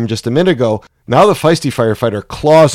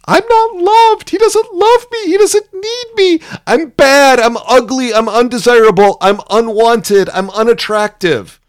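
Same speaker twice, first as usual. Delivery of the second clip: much higher, pleading little voice of hurt; high, hurt child-like plea of being unloved; wounded shame bearer; 11 semitones higher than usual. Retold or imitated. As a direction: whimper out the rejection like a wounded child. Voicing the hurt shame bearer part.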